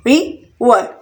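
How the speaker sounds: River in two separate beats; split into syllables. Shown as a demonstration. Teacher counting out two syllables for learners, syllable by syllable. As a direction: pause between रि and वर. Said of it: "टू syllable river में भी".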